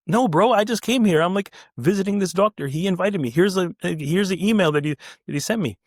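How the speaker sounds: high-pitched pleading explanation; acted protest. Visitor pleads with the guard, protesting. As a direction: plead your case, imitating an exasperated visitor.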